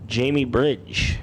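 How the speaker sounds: usual speaking voice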